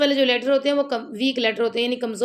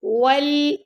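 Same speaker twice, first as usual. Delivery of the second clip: slow, drawn-out melodic tajweed recitation; Quran recitation; 4 semitones higher, much slower than usual. Shown as a demonstration. Teacher reciting the verse slowly to students, melodic chant. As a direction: chant each word long and measured.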